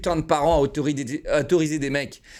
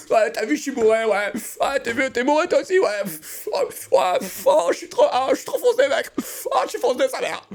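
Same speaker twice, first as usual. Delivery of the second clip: high, loud mocking party-bro voice; mock a wasted guy bragging; drunk show-off; 9 semitones higher, 3 dB louder than usual. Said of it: "C'est pour accéder à un statut social".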